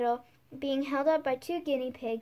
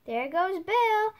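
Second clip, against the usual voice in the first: slow shout, higher and louder; chorus shout; 6 semitones higher, 6 dB louder, slower than usual. A narrator's reading. The crowd of animals yelling as Bill flies out, shouting. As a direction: call it out like the whole crowd cheering. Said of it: "a general chorus".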